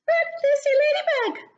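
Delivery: very high, fast chirpy call; bird cry. Acted. Bella Bird crying out to Lucy, very high and chirpy. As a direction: chirp her name out quickly. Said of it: "cried Bella".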